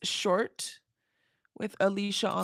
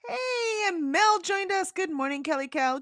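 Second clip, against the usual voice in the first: excited greeting; 12 semitones higher than usual. Real, not acted. Greeting a friend joining the stream.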